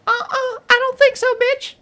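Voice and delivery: very high, loud sassy put-down. Pretend. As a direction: sass it like a diva cat. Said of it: "sometimes I give her Lakeisha".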